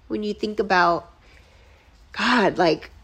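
normal talking voice

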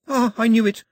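put-on girl proven right, crowing; triumphant. Girl learning the ghost girl came back, triumphant. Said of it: "said the girl triumphantly".

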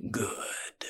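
breathy menacing whisper. A narrator's reading. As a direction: hiss it softly. Boss pleased, whispered reply.

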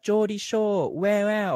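high, loud attempt at a Geordie accent; Geordie Shore catchphrase. Fan recalling the show's opening title, mock Geordie accent. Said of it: "what's the thing they say at the end of, like, the opening title?".

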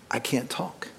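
barely voiced whisper, too choked to talk. Father crying in the car after college drop-off, whispering. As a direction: breathe the words out with no voice.

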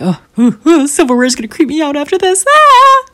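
high, loud squeamish shriek; creeped out. Acted. Mom facing a disgusting silver bug, creeped-out squealing. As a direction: recoil with hammed-up disgust. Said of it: "this was a really disgusting bug".

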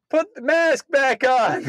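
lady's outburst. Retold or imitated. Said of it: "the lady sitting next to me just goes".

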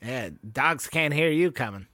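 normal talking voice

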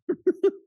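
burst of laughter. Real, not acted. Laughing at being knocked over.

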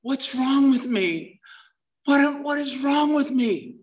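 high, slow, half-mock crying voice; imitating crying. Drunk husband watching wife leave, mock crying, high and slow. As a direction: replay the despair lightly, drawn out.

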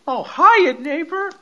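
high-pitched imitation of a friendly neighbor; sing-out sitcom neighbor hello; chirpy neighbor greeting. Voices nosy neighbor's greeting.

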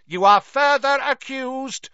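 loud high bellow. A narrator's reading. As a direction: bellow the charge across the court. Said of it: "bellowed Mister Crouch".

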